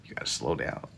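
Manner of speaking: breathy whisper, voice gone. Shown as a demonstration. Streamer repeating the advice to chat, whispered.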